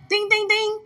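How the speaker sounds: very high sung ding ding ding; bell imitation. Mimicking the answer bell, very high chime.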